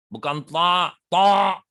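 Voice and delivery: loud mock drill of "ta" from a Quran teacher; loud letter demo. Shown as a demonstration. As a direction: bark out "Bukan ta, ta" to mock the drilling.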